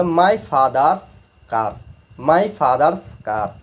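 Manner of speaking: very slow, word by word; each word slowly drawn apart; slow demo reading. Shown as a demonstration. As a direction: spell it out slowly for the class. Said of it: "তাহলে কার?".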